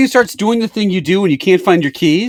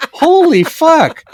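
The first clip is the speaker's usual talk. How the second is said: mock disbelief; 12 semitones higher, 3 dB louder, much slower than usual. Reacting to the yelled order.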